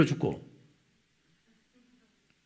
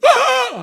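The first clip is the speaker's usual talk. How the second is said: a sudden high, loud shriek of rage; angry shriek; 9 dB louder than usual. Retold or imitated. Wife shrieking at her husband, high and sharp. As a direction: let out a furious scream. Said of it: "하고".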